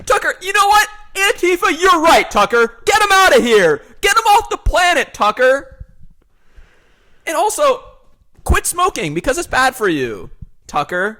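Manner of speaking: high, loud hyped-up rant; as hyper as possible. Pretend. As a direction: shout like a manic talk-show guest. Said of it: "just be as hyper as possible".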